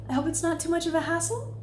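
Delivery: tentative uptalk with a rise on "hassle"; request as question. Shown as a demonstration. Coach models a request that feels too much, question inflection. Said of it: "reasonable requests into questions".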